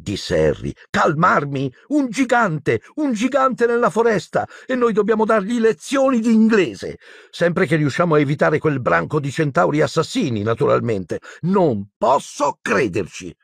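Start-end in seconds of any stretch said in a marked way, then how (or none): 0.9-11.2 very high, agitated disbelief; panicking Hermione. A narrator's reading. Hermione panics, told to calm down. 11.4-13.3 slow, high, emphatic disbelief; exasperated Hermione. A narrator's reading. Hermione ends her agitated rant.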